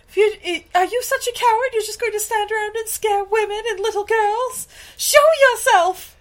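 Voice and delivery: high-pitched, indignant challenge to a coward; defiant heroine. Retold or imitated. Woman dares the cowardly ghost to appear, defiant. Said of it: "she was like".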